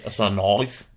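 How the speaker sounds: low, soft Australian from the throat; throaty Australian. Shown as a demonstration. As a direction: push the Australian vowels down in the throat. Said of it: "from the bottom of your throat".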